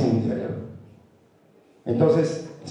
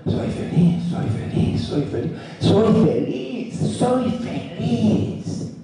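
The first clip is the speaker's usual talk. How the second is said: "soy feliz" building up, the last ones higher and very slow; felt with emotion; 7 semitones higher, much slower than usual. Shown as a demonstration. Speaker shows the right way to say it: heartfelt, rising and slowing. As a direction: feel every "soy feliz" in the whole body, stretching the last ones out. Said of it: "Y otra cosa".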